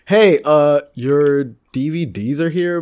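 mock store clerk. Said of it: "she's like".

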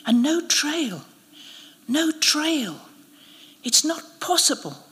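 high, airy, bewildered voice; thin, high and slow, baffled; weak disbelief. A narrator's reading. Injured priest, weakly disbelieving.

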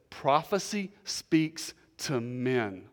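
very slow, each word set apart; slow and deliberate. Real, not acted. Pastor gives what to remember today, slow and deliberate. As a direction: spell out the takeaway word by word. Preacher drills point to remember.

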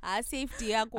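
safety yako as a grandmother would say it; old Zimbabwean accent on safety yako; grandmother's Zimbabwean accent. Shown as a demonstration. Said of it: "the way I've heard my great grandmother would have said it".

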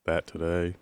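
low, quiet, sleepy mumble; sleepy teenager voice. Retold or imitated. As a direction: mumble it like a groggy teen. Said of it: "A sleepy teenager voice".

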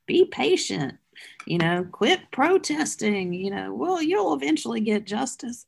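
a hint of a smug pastor preaching patience. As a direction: talk down to the protesters a little.

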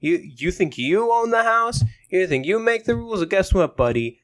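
high, fast ranting dad; hammed-up furious father tirade; mock angry dad. Dad yelling at rude son.

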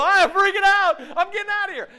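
high, loud mock-panicked yell; acted panic. Preacher acting someone inside a burning house, panicked yell. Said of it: "if your house is on fire and you're in it".

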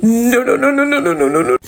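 high rapid-fire string, sinking at the end; acted panicked refusal. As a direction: reel off a mock-panicked refusal in one run. Acts out her panicked refusal.

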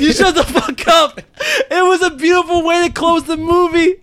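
high pitched, teary voice; laughing through tears; tearful. Real, not acted. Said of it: "I'm sorry, I'm tearing up".

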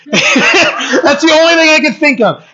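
laughing it out, voice jumping up high. Real, not acted. Preacher laughing at his own angry reaction. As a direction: laugh through the confession, caught by its absurdity.

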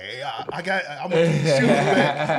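scrambling excuse, half laughing; panicked plea. Retold or imitated. Friend caught in the room, pleading to keep shoes. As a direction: imitate the friend backpedaling fast.

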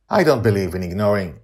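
loud, insistent opening; forceful start. Acted. Amali answering Madeline, forceful and loud. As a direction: push the words out forcefully. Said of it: "Amali begins forcefully and then trails off".

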